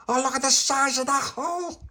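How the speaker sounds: very high-pitched wonder; amazed exclamation. Acted. Tony seeing the hole in the wall, amazed exclamation. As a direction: squeal in astonishment.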